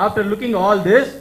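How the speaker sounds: ordinary delivery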